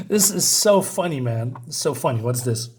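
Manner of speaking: ordinary talk, nothing marked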